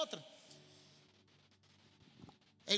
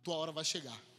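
lower, softer, repeated promise; intimate preaching; 8 semitones lower, 5 dB quieter than usual. Acted. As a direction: speak it gently into each person. Soft encouraging refrain. (pregação suave e íntima, voz baixa)

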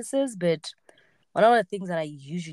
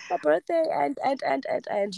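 high, stammering mock whine; flustered excuse; 8 semitones higher than usual. Explaining swollen eyes to the gym guys, flustered. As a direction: pitch it up and trip over the words.

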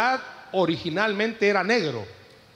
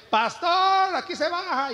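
mock conductor call, much higher; 12 semitones higher, slower than usual. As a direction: sing out the stop to the pastor, much higher. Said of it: "ya cuando iba llegando".

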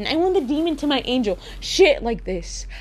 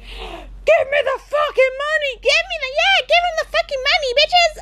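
very high squealing robber shout; mock robber yell. Robber couple yelling at the bank clerk, squealed mock. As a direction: scream it like a cartoon bank robber. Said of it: "they get into, like, the bank".

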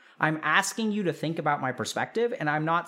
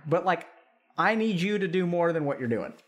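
ordinary talk, nothing marked

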